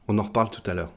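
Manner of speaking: ordinary talk, nothing marked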